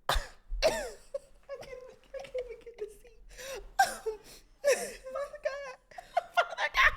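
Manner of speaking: high, breathless crying laughter; laughing hysterically. Real, not acted. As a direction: squeal through helpless laughter. Laughing fit after burp alphabet.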